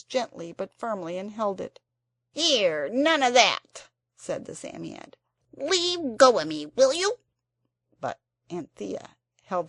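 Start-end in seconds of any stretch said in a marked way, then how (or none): 2.3-7.2 grumpy creature voice, higher and louder; cross Psammead. A narrator's reading. The cross Psammead tells Anthea to let go. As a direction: snap at the girl holding you.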